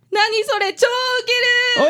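high, slow jeering laugh; mocking laugh. Girl mocking the detective's name, laughing. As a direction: drag it out to tease.

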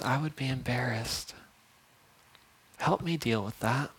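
soft, quiet praying voice; hushed prayer. Acted. Pastor praying aloud softly to God. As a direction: confess it to God in a hush.

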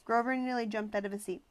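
plain reading voice

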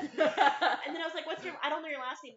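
laughing while telling. Real, not acted. Laughing while retelling Trevor chat.

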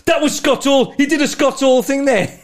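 high, loud match call; excited commentator. Retold or imitated. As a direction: shout the recognised move like a ringside commentator. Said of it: "And then he goes".

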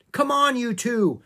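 high, loud hurrying call; urging shout. A narrator's reading. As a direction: shout to hurry them along. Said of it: "Jack shouted".

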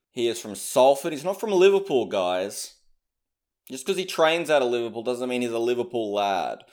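ordinary delivery

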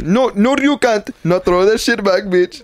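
put-on high-pitched, comic Indian accent; mock Indian accent. Answering friend's bit, high mock Indian accent. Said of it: "sounds like mad Indian".

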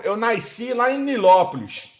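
"nasci" said as "naisci"; carioca added I. Retold or imitated. Man mimics a Rio native naming his birthplace, carioca "naisci". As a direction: slip an extra I into "nasci", carioca style. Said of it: "onde tá o I no nasci?".

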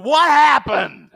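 loud, high, drawn-out mock yell; stretched-out shout of alarm; imitated shocked shout. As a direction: act out a wife yelling down the phone. Wife's alarmed phone call retold.

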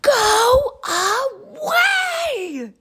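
huge, high, stretched-out yell; furious storybook tantrum. A narrator's reading. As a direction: bellow each syllable slowly at full volume. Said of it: "yelled Pom Pom".

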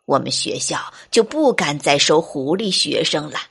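put-on low old-teacher voice, resigned; sighing goat teacher. Goat teacher sending little fox home, low and sighing. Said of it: "山羊老师看看小狐狸， 叹了口气".

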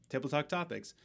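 ordinary delivery

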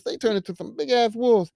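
ordinary delivery

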